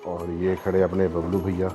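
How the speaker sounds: ordinary talk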